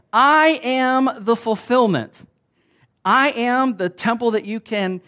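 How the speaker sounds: loud, high, emphatic preaching; preaching at full force. Acted. Preacher voicing Jesus to the congregation, full force. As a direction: proclaim each I am like a herald.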